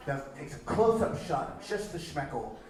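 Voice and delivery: ordinary delivery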